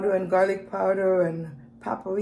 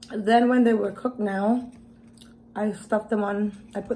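talking while chewing; muffled between bites; mouth full. Real, not acted. Seen 4: Gnocchi forked into mouth at +7.2; chewing with closed lips throughout.

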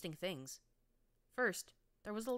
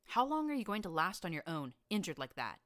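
urgent plea; 2 semitones higher, 6 dB louder than usual. A narrator's reading. Urging injured friend.

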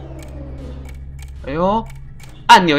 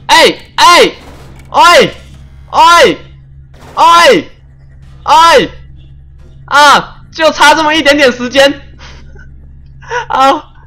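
frustrated wails; 8 semitones higher, slower than usual. Real, not acted. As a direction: wail at missing by a hair. Narrowly fails timed challenge.

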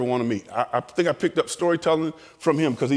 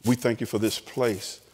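closing prayer; 5 semitones lower than usual. Real, not acted. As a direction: lead the room in prayer.

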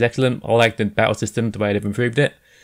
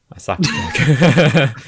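slow, louder, laughing through it; 5 semitones higher, 5 dB louder, much slower than usual. Real, not acted. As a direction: giggle the punchline slowly. Joking drug pun, laughing.

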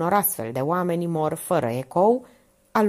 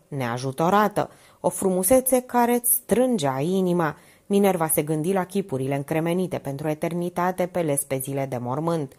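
ordinary delivery

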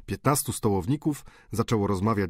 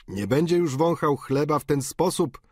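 mocking boarder; 6 semitones higher, 3 dB louder than usual. A narrator's reading. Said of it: "naśladując grymas starego".

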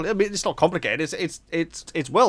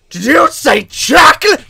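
loud, high, drawn-out yell; shouted catchphrase; 11 semitones higher, 9 dB louder, slower than usual. Acted. Gamer reacts to finding chocolate, mock excited shout. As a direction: bellow it in sudden mock excitement. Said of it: "I had to say that every single time".

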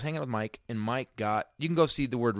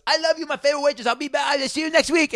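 very high, loud, rushed crazy voice; over-the-top manic goodbye, pitched way up; acting crazy; 8 dB louder than usual. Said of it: "He started acting crazy".

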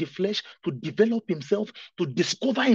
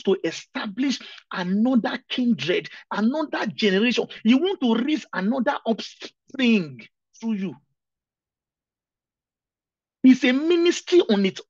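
loud, higher preacher's build on marriage as ministry; fervent preaching; 5 semitones higher, 8 dB louder than usual. Acted. Pastor to congregation on marriage as a ministry, loud and fervent. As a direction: proclaim it to the whole room.

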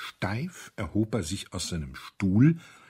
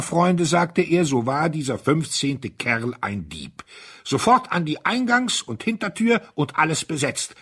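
higher and louder, commanding; rally the men to action; commanding orders; 7 semitones higher, 6 dB louder than usual. A narrator's reading. Colonel rallying his friends to guard the doors, commanding.

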